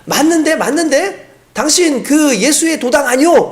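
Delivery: won't let it go; insistent servant girl. Retold or imitated. Servant girl follows Peter, pressing him, high and slow. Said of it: "그 여인이 또 쫓아오는 거예요".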